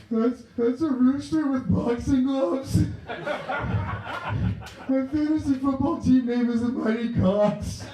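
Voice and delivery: very high, slow tearful wail; mock crying. Arrested man explaining tattoo to cop, high comic crying. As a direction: squeal it out through hammed-up sobs. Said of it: "And boy, was I a crier".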